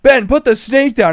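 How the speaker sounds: high, loud, slow plea; retold yell. Chased by coworker holding a snake, high slow loud plea. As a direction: yell it while running away. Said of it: "I'm like".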